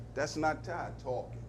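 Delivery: usual speaking voice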